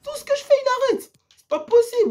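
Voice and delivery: high pitched gamer moan; whiny complaint. Real, not acted. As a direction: whine like everything is unfair. Keeper stops every shot.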